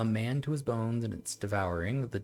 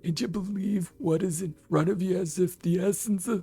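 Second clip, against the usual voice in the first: put-on very old man; 10 semitones higher than usual. Grandpa voice resumed.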